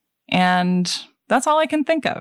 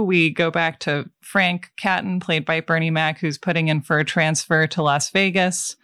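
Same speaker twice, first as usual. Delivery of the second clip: ordinary talk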